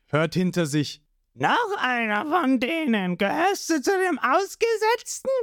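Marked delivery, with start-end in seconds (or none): 1.3-5.3 fishman voice. A narrator's reading. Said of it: "sagte ein Fischmensch".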